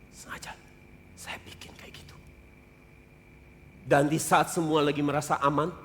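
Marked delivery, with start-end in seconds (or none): 0.1-2.2 ominous whisper of a warning; God's chilling whisper repeated; eerie whisper. Acted. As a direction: breathe it out as a threat. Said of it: "Serem nggak?".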